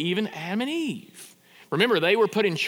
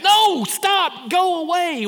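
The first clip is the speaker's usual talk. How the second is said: high, loud cry of warning; shouted plea; 11 semitones higher, 6 dB louder, much slower than usual. Acted. Preacher reliving his own horror-movie outburst, shouted high. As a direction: yell it high, desperate to stop them.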